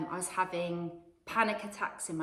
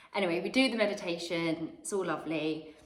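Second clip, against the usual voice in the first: normal talking voice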